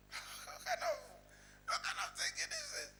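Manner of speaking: thin, breathy high squeak of disbelief; exasperated disbelief. Acted. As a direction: react as if you can't believe what you read. Exasperated reaction to the text.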